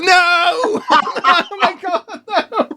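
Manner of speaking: high, loud, horrified laughing cry of no; real mortified shock, laughing at himself; a real cry of shock when he finds out who the stranger was; shocked disbelief. Real, not acted. Realising he met Renfrew.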